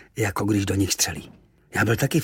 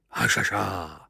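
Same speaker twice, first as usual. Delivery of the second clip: smiling; slower than usual. Acted. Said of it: "usmál se".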